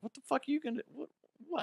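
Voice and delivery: high, hushed incredulous stammer; retold bafflement. Clerk to an angry olive customer, high soft bafflement. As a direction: squeak it out softly in disbelief.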